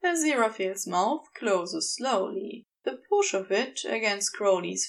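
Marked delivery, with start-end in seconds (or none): none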